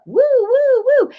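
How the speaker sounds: high-pitched "woo woo" fire truck siren; silly siren noise. Shown as a demonstration. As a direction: wail like a toy fire engine. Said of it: "when you make silly noises".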